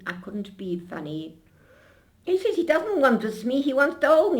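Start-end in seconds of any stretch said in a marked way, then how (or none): 2.2-4.4 high, loud rush of excitement; blurting it out, louder and higher; catching herself mid-gush; excited outburst. Real, not acted. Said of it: "What am I talking like this for?".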